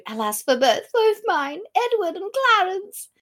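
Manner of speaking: high theatrical lament; Duchess. Acted. Duchess mourning Edward and Clarence, mock theatrical wail. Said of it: "Duchess of".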